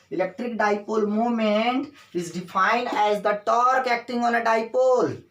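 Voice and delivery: very slow, stretched-out definition; deliberate teacher's dictation, key words drawn out. Shown as a demonstration. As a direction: dictate it so the class can write along.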